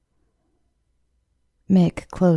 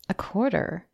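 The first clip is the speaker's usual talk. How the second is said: a touch of offended disbelief, softer; affronted; 2 semitones higher, 5 dB quieter than usual. A narrator's reading. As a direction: balk at the small share. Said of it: "voice sounded affronted".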